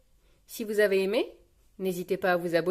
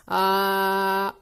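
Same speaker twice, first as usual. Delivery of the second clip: long, loud, level open vowel; doctor's-check "aah"; 5 dB louder than usual. Acted. Peppa examined by nurse Suzy, sustained aah. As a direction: hold a steady aah for the nurse.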